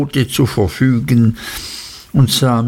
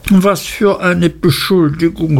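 higher, aghast cry of offence; mildly hammed-up horrified outcry; shocked exclamation; 7 semitones higher than usual. A narrator's reading. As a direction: recoil at the accusation. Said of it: "rief Dora aus und sah ganz entsetzt drein".

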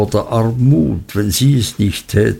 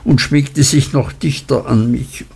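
plain reading voice

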